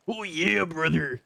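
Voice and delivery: gruff wrestler promo, slow and high; Hulk Hogan voice. Retold or imitated. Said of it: "that's more like Hulk Hogan".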